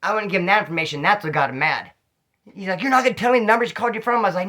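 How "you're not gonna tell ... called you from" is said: angry retort. Retold or imitated. Angry cellmate demands the number.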